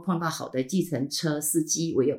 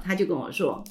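ordinary talk, nothing marked

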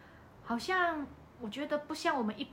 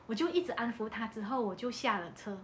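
normal talking voice

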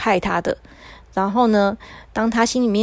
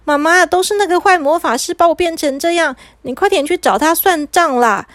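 put-on high-pitched child pleading with mum; a touch of panic, pitched up; nervous urgent plea; 6 semitones higher than usual. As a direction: urge your mother to act, agitated. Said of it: "阿切这时候很紧张了".

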